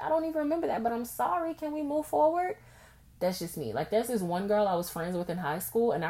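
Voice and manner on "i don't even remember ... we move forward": breezy apology. Retold or imitated. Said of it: "And I'll be like".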